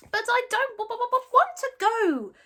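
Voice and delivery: high stuttering little dragon voice; reasonable dragon. Acted. Toothless reasoning back at Hiccup, high stuttering dragon. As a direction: stammer calmly like a tiny dragon. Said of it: "equally reasonably".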